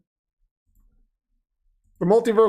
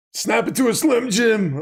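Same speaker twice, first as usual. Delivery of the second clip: higher, strained wrestler promo voice; a badly done Macho Man catchphrase; terrible Macho Man; 5 semitones higher than usual. Streamer botching a wrestler impression for chat, bad Macho Man voice. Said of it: "That's a terrible Macho Man Randy Savage impression".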